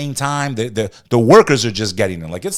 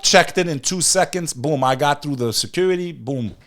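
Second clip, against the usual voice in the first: ordinary talk, nothing marked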